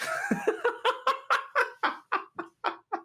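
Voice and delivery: real laugh after the punchline; high laugh. Real, not acted. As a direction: burst of laughter. Laughs at own story.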